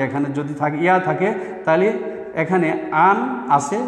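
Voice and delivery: ordinary delivery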